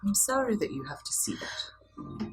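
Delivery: soft unvoiced apology; whispering. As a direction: breathe it out gently.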